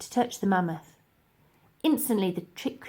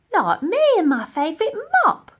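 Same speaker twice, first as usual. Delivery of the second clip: much higher, louder cheerful cleaner; bright chirpy Dotty, much higher; Dotty's voice; 12 semitones higher, 9 dB louder than usual. Acted. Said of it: "added Dotty".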